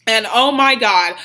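usual speaking voice

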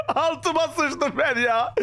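slow, high, laughing through the words; nervous laugh after a fright. Real, not acted. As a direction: laugh off the scare.

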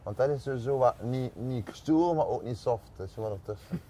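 ordinary talk, nothing marked

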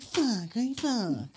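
softly imitated frustrated wife; muted annoyance, imitated. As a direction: keep the cursing under your breath. Angry wife under her breath.

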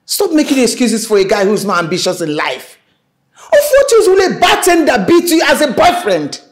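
high, loud scolding that builds; angry shouting. Acted. Mother berates daughter for defending a bartender boyfriend, angry shouting. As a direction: berate with mounting fury.

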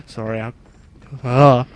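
queasy, gagging a little. Real, not acted. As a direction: talk while fighting a gag. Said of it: "gagging a bit here".

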